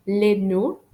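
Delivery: French "Les no" ending on the vowel; silent final letters. Shown as a demonstration. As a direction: end "no" openly, without D or T. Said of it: "I don't pronounce the letters D and T".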